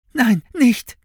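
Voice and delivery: high, loud, desperate begging; pleading. A narrator's reading. Seized boy to his captor, desperate pleading. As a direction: beg in a high boy's cry. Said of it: "flehte Finn".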